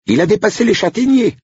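high, thrilled announcement; excited lady of the house. Acted. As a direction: report it in a giddy high voice. Madame reports.

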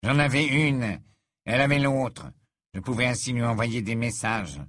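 put-on tense, defiant young wizard; a scared boy trying to sound tough; nervous teen voice. Malfoy voiced in audiobook.

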